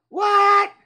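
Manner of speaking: loud, high, indignant what; incredulous outburst. Real, not acted. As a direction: cry it out in disbelief. Indignant reaction to the message.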